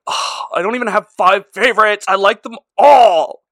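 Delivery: sing-song dodge of the question; a hint of whiny Jesus. Jesus voiced in retelling.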